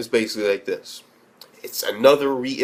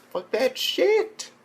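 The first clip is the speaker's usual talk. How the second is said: high drawn out; 13 semitones higher, much slower than usual. Real, not acted. Drawn-out repeat.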